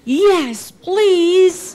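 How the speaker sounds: high, loud, delighted acceptance; excited child's plea; eager little girl. Acted. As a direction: jump at the chance to join in. Thrilled child accepting invitation.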